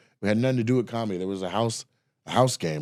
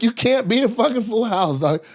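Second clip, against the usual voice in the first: high-pitched cocky comeback; retold protest; 11 semitones higher, 3 dB louder than usual. Player with full house answering the boaster, high retold protest. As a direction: argue back in a raised, high voice. Acting own protest at poker table.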